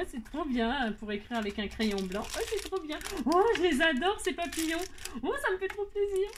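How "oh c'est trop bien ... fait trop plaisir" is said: high, thrilled gush over the gift; higher, gushing with joy; delighted squeal. Real, not acted. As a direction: squeal with delight at something cute.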